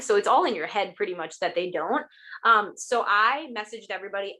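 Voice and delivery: ordinary talk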